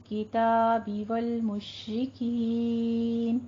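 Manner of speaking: slow, measured tajweed chant; drawn-out melodic verse recitation; Quran recitation. Shown as a demonstration. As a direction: recite with long held vowels and hummed nasals.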